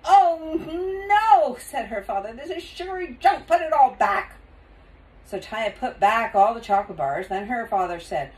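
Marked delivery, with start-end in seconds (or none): 0.0-1.6 loud, high dismayed cry; exclaimed refusal. A narrator's reading. Father refuses the cartful of candy, loud dismay. 2.3-4.3 raised, higher scolding voice; scold, hitting the last words loud; exasperated father. A narrator's reading. Father orders Taya to return the candy, exasperated.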